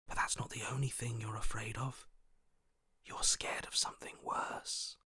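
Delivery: soft, menacing whisper. Acted. Voice taunts the princess about her fear, whispered. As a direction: whisper it close, prying at fear.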